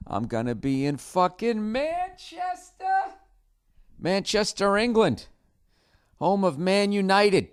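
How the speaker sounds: high, drawn-out hammed-up hype; mock-excited announcement. Comedian hyping his Manchester show, hammed-up excitement. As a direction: belt out the city like a hammy announcer.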